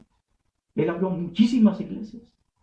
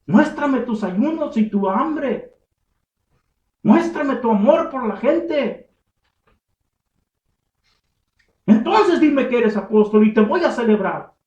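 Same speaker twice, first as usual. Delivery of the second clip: slow, weighty demand, building louder; slow challenge; 6 semitones higher, 6 dB louder, slower than usual. Pretend. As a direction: lay each demand down slowly, then rise. Challenge to self-styled apostle. (desafío lento y enfático que va subiendo)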